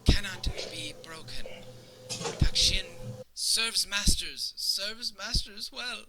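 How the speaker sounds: high, loud, slow insistence of a distraught servant; accentuated broken Common. Acted. Crying servant mashes collar pieces together, words accentuated in broken Common. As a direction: punch out each word, high and desperate. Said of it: "kind of accentuating some of the words that he's saying".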